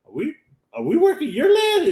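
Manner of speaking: mock incredulous. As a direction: imitate a worker squeaking in disbelief. Retells worker questioning old man.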